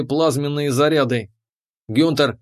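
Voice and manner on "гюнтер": plain reading voice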